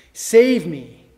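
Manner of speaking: loud, pleading cry; urgent plea. Acted. Preacher voicing David's loud plea for rescue. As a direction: beg to be saved.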